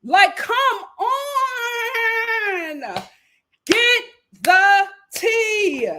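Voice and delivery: slow, hammed-up exasperation; exasperated plea. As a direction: stretch each word in a begging wail. Pleading with hosts to dig deeper.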